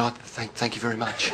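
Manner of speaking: very soft, low, halting thanks; awkward thanks. Acted. Puzzled Australian not wanting to be rude, softly awkward. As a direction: trail out a polite, unsure thank-you. Awkward polite reply to condolences.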